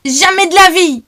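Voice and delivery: high defiant outcry; girl defying the thugs, crying out. A narrator's reading. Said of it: "s'écrie Claude".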